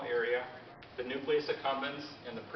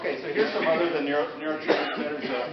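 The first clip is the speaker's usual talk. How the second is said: raised voice so the room can hear; louder, obliging lecturer voice; talking louder; 2 semitones higher, 8 dB louder than usual. Real, not acted. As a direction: project it to the back on request. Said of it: "can you talk louder?".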